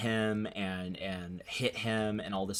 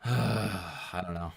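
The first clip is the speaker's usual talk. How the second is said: sighing; 3 semitones lower, much slower than usual. Real, not acted. Weary sigh before repeating.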